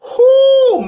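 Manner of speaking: loud high whoop with a cry in it; emotional whoop. Real, not acted. Host overwhelmed by good news, loud tearful whoop. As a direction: let out a joyful cry. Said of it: "I'm getting kind of emotional here".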